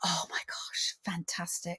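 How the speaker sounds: hushed, voiceless rave; awed whisper. Real, not acted. Guest reacts to host naming a beloved film, awed whisper. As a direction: whisper it in reverent admiration.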